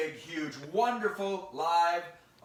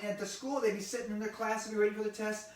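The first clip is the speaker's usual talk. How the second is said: ordinary delivery, nothing marked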